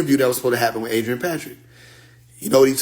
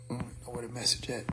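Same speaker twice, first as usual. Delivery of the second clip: a rushed, breathy mutter while hunting for the message; whispered aside; 2 semitones lower, 17 dB quieter, much faster than usual. Real, not acted. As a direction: whisper it fast to yourself, searching. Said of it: "muttering while searching the phone".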